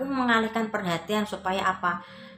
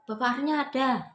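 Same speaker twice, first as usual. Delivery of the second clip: higher-pitched, mock-stern debt collector; raised pitch, mildly barked question; retold snapping demand; 7 semitones higher than usual. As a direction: imitate a collector demanding an answer. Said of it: "sambil bentak-bentak lah".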